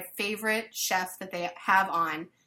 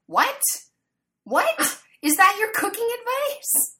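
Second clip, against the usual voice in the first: high, loud squeal of disbelief; incredulous mock reaction; 14 semitones higher, 6 dB louder than usual. Onlooker reacting to the chef's odd advice, mock incredulous. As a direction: pitch leaps way up, aghast at the advice.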